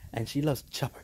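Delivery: ordinary talk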